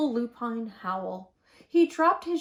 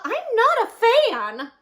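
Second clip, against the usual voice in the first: much higher, louder small sheep voice; high sheep voice for Babwa; 12 semitones higher, 10 dB louder, slower than usual. A narrator's reading. Babwa the sheep asking William, in a high voice. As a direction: pitch it up as the timid sheep Babwa. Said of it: "said Babwa".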